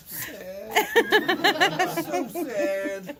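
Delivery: hammed-up sorrow played for laughs; mock sadness. Instructor joking about his future rat-tourniquet fame, mock sad. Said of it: "I'm gonna be super sad about it".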